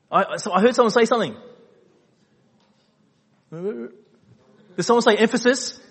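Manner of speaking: loud, high, playful mock question; pretend overhearing. Preacher pretending someone in the congregation answered, loud mock surprise. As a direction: feign catching a shout from the crowd.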